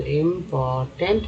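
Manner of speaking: Important drawn out part by part; teacher sounding out important in slow chunks; broken into parts. Shown as a demonstration. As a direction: split important slowly into इम, पोर, tent. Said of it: "TNT को tent बोलते हैं".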